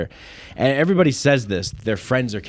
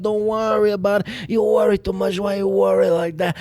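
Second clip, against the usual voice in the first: put-on Italian American accent; 7 semitones higher than usual. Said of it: "I said".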